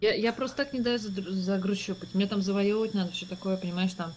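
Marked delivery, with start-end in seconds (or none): none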